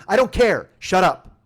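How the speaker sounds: loud, curt shutdown; cop yelling. Retold or imitated. Cop cutting off the driver's protest, yelling. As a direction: cut him off with force. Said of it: "I'm getting yelled at by cops".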